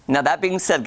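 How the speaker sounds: ordinary talk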